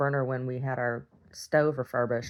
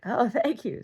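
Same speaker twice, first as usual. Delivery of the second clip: higher, polite thank-you; the smiling man, voiced a bit higher; light mock thanks; 10 semitones higher, slower than usual.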